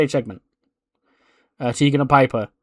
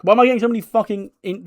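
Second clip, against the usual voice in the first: higher, fast disbelieving outburst; real exasperation; 8 semitones higher, faster than usual. Real, not acted. Vlogger venting about injuries, exasperated. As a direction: blurt it out in disbelief.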